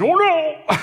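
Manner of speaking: high startled "oh no"; alarmed cry. Retold or imitated. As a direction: blurt it out in shock. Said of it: "he let out this grunt".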